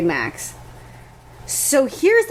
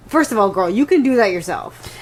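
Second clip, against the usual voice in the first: usual speaking voice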